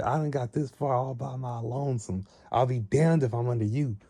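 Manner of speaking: high-pitched, mock-indignant refusal; defiant. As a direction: refuse to serve under anyone. Acted defiance in a quarrel.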